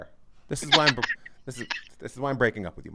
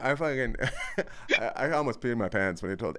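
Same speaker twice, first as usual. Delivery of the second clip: ordinary talk, nothing marked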